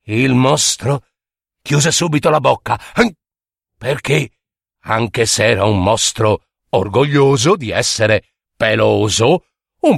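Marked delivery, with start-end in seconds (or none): none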